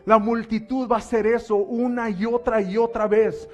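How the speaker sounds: loud, high, full-force preaching; fiery preaching. Acted. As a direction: preach it out to the congregation.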